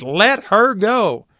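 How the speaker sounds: a healer giving his last command; commanding order. Retold or imitated. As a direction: draw out each word with authority. Final command to the women.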